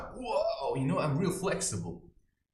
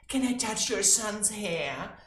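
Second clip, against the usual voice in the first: mock sweet request; 6 semitones higher, slower than usual. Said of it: "what are you supposed to say as a parent".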